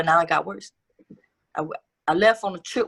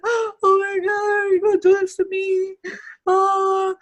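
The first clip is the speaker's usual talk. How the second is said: high, slow, wailing put-on sob with a loud gasp; mock fake crying; 4 dB louder, slower than usual. Stepmom fakes tears in front of the cops, hammed-up wailing. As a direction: ham up a crocodile-tears wail. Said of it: "fake crying".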